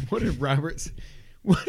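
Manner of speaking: ordinary talk, nothing marked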